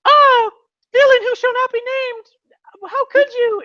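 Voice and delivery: very high, loud mock horror; hammed-up damsel outrage; melodramatic heroine. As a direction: gasp it out like a betrayed heroine. Acted heroine confronting the villain.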